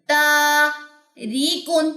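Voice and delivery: تاریکن with the long aa stretched very slowly and dissolving; slow recitation drill. Shown as a demonstration. Teacher recites slowly for students to repeat. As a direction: chant تاریکن slowly, aa melting into air. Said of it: "کرتے ہم نے غائب کر دینی ہے".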